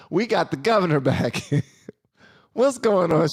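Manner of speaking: laugh, then announce it with delight; laughing welcome. Real, not acted. Host greeting the returning governor, laughing and excited.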